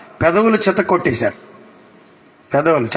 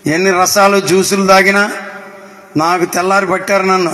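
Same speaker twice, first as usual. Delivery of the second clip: ordinary talk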